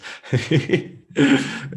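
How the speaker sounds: bright burst of real laughter, high and loud. Real, not acted. Host reacts to the channeled answer, laughing.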